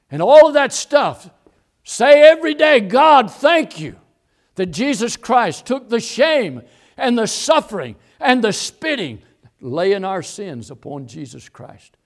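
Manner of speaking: loud, high pulpit exhortation, easing toward the end; impassioned preaching, starting at a shout and settling; full-force preaching. Real, not acted.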